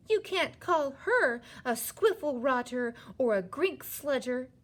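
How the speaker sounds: high, loud, scolding protest; indignant anger. A narrator's reading. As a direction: object hotly on someone's behalf. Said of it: "getting angrier and angrier".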